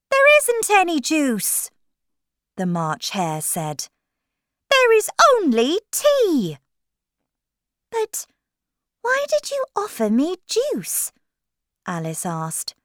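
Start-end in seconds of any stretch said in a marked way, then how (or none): 0.1-1.7 high, loud storybook animal voice; March Hare. A narrator's reading. 4.7-6.6 high, loud storybook animal voice; March Hare. A narrator's reading. 7.9-11.1 put-on high-pitched girl character voice; Alice. A narrator's reading. Alice voiced, questioning the Hare.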